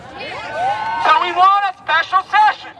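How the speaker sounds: drawn-out slow shout to the crowd; rousing demand, shouted slowly; rallying shout. Real, not acted. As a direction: call it out to get the room chanting. Rally crowd, calling for action.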